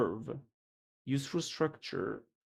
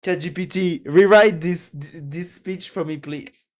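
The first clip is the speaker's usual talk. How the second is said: loud, higher mock plea to the chatbot; mock politician; 4 semitones higher, 11 dB louder than usual. Mocking a politician asking ChatGPT for a speech, loud mock plea. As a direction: ham up a lazy politician.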